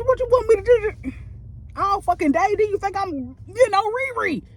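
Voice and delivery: a touch of mocking disbelief; sarcastic outrage. Real, not acted. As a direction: sneer at them with a shrill mock question. Sarcastic rant at coworkers.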